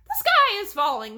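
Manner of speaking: panicked cry. A narrator's reading. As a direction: shriek it out in terror. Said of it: "a chicken cried".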